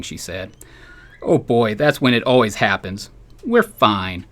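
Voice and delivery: dry, deadpan storytelling read; humorous column reading. Acted. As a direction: read the column aloud to a listener, keeping the jokes dry. Reads his humorous column aloud.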